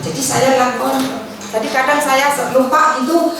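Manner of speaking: ordinary talk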